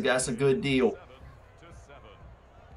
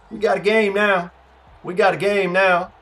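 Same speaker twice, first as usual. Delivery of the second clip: high, loud excited declaration; fired-up announcing; 6 semitones higher, 6 dB louder than usual. Real, not acted. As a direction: call out that the contest is on. Rallying after a turn of play.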